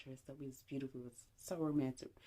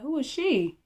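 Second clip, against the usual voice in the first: high, loud challenge; retold confrontation, pitched up and punched out; demanding question; 11 semitones higher, 10 dB louder than usual. Woman questioning her date about a caller, demanding.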